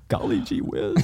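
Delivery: high, hushed golly-gee voice with a laugh; mock innocent. To the agent who caught him, a hammed-up innocent golly gee. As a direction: play the wide-eyed innocent kid. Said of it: "I'm doing the whole".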